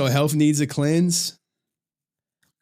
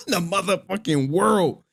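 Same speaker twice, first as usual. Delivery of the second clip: higher, punchy brag; hyped boast; 6 semitones higher than usual. Real, not acted. Streamer bragging to chat about the world ranking, hyped. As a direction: crow about it, laughing at himself. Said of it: "hyping his own third place".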